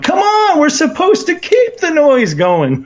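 high, laughing plea to keep the noise up; urging the crowd. Retold or imitated. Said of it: "I'm, like, going".

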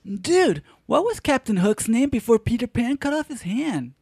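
high, spacey stoner dude. Acted. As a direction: ask it like a dazed surfer dude. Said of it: "do it again".

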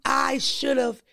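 usual speaking voice

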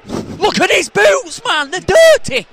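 high, loud, slow jeer; mock shout. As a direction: yell it like a terrace jeer. Mocking a player's boots.

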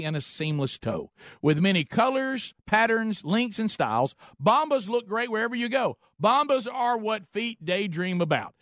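bright, upbeat sponsor pitch; ad read. Acted. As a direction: sell it like a radio spot. Reads sponsor ad.

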